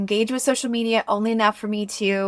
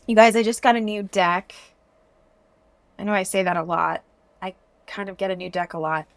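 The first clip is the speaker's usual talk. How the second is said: normal talking voice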